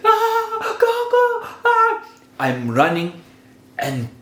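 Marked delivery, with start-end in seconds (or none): 0.0-2.0 very high-pitched screamed call; screaming for granny. Retold or imitated. Storyteller acting out calling granny while running, screaming. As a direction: re-enact a panicked scream for help. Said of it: "calling my granny".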